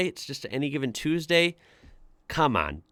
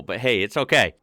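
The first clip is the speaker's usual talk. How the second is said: ordinary talk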